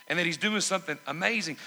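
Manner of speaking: usual speaking voice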